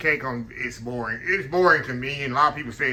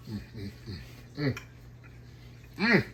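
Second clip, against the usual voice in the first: savoring hum; 4 semitones lower, 7 dB quieter than usual. Real, not acted. Tasting cake just bitten.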